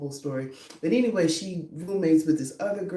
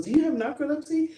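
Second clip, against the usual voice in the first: quoting the roommate; 11 semitones higher, faster than usual. Retold or imitated. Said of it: "she goes".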